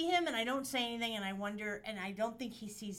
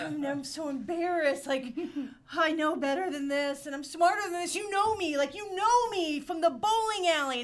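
high, loud, melodramatic plea; fifties movie pleading; 7 semitones higher, 12 dB louder than usual. Retold or imitated. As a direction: beg like a fifties film heroine. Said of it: "Now I sound like a fifties movie".

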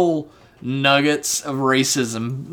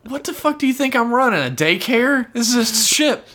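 higher, fast indignant snap; mock angry captain; 10 semitones higher than usual. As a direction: scoff at the daycare idea. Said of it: "he should have just been like".

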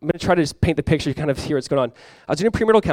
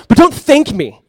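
loud, higher-pitched exasperated objection; exasperated protest; 7 semitones higher, 5 dB louder, slower than usual. Retold or imitated. Pastor objecting to the man's thanks, exasperated. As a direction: push back hard, voice raised.

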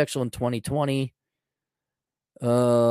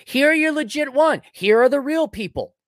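pitched way up, sneering; mocking high voice; 13 semitones higher, 5 dB louder than usual. Mocking the gatekeepers in a squeaky high voice. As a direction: parody them in a prissy high voice.

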